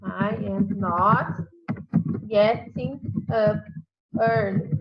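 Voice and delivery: "I am not getting up early" said very slowly, word by word; slow, careful classroom demo; slow example sentence. Shown as a demonstration. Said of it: "um exemplo".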